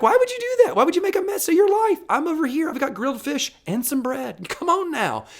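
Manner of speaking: very high-pitched, loud at first, then easing; incredulous pleading. Acted. Jesus asks why you make a mess of life, high comic disbelief. Voices Jesus remonstrating with the searcher.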